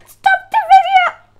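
mom yelling. Retold or imitated. Mom barging in on the recording, yelling high and loud. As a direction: play the mom bursting in, shrill command. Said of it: "my mom is gonna come in here".

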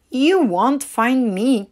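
a cheeky little duckling daring Mama to find him; cried out. A narrator's reading. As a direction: Waddle cries out to Mama, slow and playful. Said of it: "cried Waddle".

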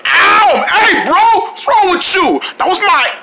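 high, loud yelp of complaint; indignant outcry after getting hurt; pained protest. Acted. Hurt ear, protests to friend.

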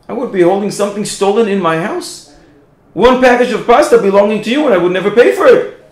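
high, loud, eager retelling; excited explanation. Retold or imitated. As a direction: burst out with delighted relief. Man explains his joy.

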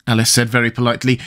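ordinary delivery, nothing marked

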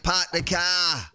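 mock Boston accent. Streamer comparing Boston with Jersey, mock Boston accent. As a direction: stretch out a caricatured Boston accent. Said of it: "I guess Boston's like".

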